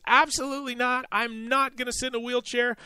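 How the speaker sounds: high mock voice, indignant refusal; lightly voiced huffy woman turning down help; imitating the passenger. Passenger refusing the wheelchair, high indignant mock voice. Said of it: "She says".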